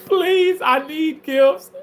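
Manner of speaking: high pleading beg for gifts; mock begging. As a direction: whine for gifts, hammed up.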